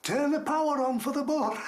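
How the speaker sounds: high-pitched call back; retold holler, stretched high; imitated distant shout. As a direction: shout it back like someone down the tunnel. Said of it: "this voice between me and the gate shouting".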